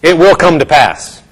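firm, emphatic declaration of faith; bold declaration. Acted. Believer standing up to answer the devil, bold declaration. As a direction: proclaim it boldly, word by word. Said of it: "That's when we get up and say".

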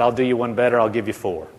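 soft, low and easy; sweet, unangered. Retold or imitated. Jesus answering Brother Hagin's demand, soft and sweet. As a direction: offer it gently, without any anger. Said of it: "he smiled real sweetly and said".

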